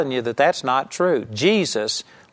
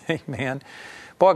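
ordinary talk, nothing marked

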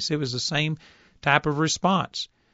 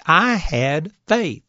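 emphatic; much slower than usual. Real, not acted. Preacher stressing his point.

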